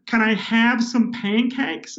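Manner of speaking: slow, high, put-on American ordering voice; British man adjusting his voice to be understood, American accent; Americanized order.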